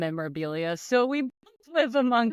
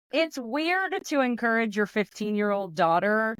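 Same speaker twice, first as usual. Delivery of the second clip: usual speaking voice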